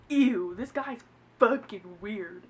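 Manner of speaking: slow, drawn-out "ew"; disgusted. Retold or imitated. Telling a friend about a creepy guy, disgusted. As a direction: recoil in disgust, dragging the words out.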